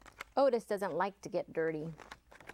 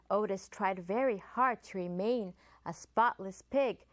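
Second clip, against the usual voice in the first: ordinary delivery, nothing marked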